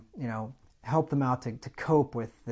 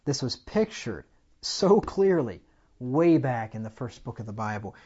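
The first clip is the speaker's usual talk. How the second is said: ordinary delivery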